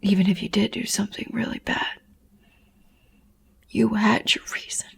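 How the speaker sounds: half-whispered, careful comfort; hushed reassurance. Acted. Mother telling her child they had their reason, hushed. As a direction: soothe them in a near whisper.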